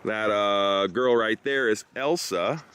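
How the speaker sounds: ordinary talk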